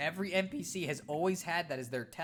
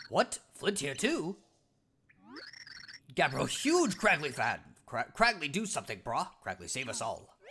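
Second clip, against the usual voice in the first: caveman Cragnon voice; 3 semitones lower than usual. Acted. Scared Cragnon hiding from the Floro Sapiens, blunt caveman voice. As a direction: bark it out like a dim, eager caveman.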